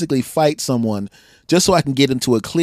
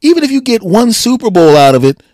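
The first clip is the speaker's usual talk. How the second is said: mock fan pleading loudly at the team; exasperated yell; 8 semitones higher, 6 dB louder than usual. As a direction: hammer home pay the man. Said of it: "I'm sitting there going".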